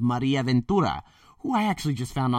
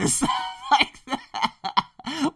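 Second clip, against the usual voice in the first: laughing; 14 semitones higher, slower than usual. Real, not acted. Laughing at the friend's joke.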